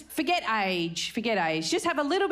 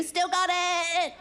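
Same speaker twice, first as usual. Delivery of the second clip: slow, high, drawn-out boast; cocky brag; 10 semitones higher, much slower than usual. Pretend. Comedian bragging to the crowd, slow cocky boast. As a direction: preen about still having it. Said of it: "self-praise brag".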